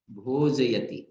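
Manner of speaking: भोजयति rushed as भोज्यति; wrong clipped pronunciation. Shown as a demonstration. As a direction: clip भोजयति short as a mistake. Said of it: "ऐसा बोल देते हैं". (गलत, छोटा भोज्यति)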